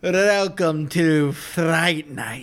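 slow, drawn-out Transylvanian attempt; half-successful Transylvanian accent, taking its time. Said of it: "I can't do it either".

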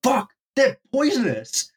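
mock panic, pitched high and stretched out; freaked out. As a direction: imitate a friend's high, drawn-out alarm. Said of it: "he gets freaked out and goes".

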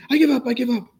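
high, loud panicky cry of giving up; frightened surrender. Retold or imitated. Small old woman surrendering to the officer, scared loud cry. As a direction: squeal it out, begging him not to shoot.

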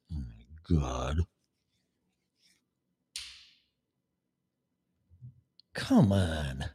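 whispered, slow exasperation; real exasperated whisper; whispered disbelief. Real, not acted. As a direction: breathe it out in disbelief. Disbelief after mock quote.

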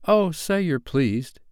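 high, drawn-out plea; lightly voiced, hopeful child; Nibs pleading. A narrator's reading. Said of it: "cried Nibs".

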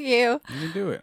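high, loud sarcastic cheer. Acted. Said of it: "you sound sarcastic".

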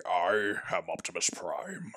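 low, slow and hushed robot hero; quiet, weighty heroic declaration; Optimus Prime impression. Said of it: "then I just wanna say".

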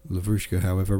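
plain reading voice

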